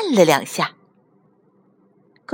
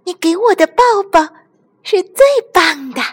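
high, louder little creature voice; delighted squeaky toy; 11 semitones higher, 5 dB louder, faster than usual. Acted. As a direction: praise a friend in a high toy voice. Squeaky toy praises the hug. (又尖又亮的小玩具嗓音，开心夸奖)

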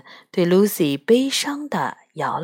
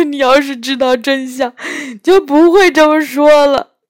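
tearful faun voice, high and louder at the end; crying while speaking; 6 semitones higher, 6 dB louder than usual. A narrator's reading. Faun weeping to Lucy, tearful. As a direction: speak through the tears. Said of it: "托木纳斯边哭边说".

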